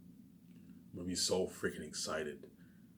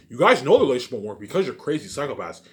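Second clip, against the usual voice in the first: angry rant; 5 semitones higher, 13 dB louder than usual. Real, not acted. Ranting at those who wronged him.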